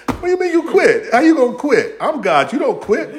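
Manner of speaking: high, loud mock-stern rebuke; God scolding. God telling Elijah he can't quit, mock-stern scolding. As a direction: scold him like a disbelieving boss.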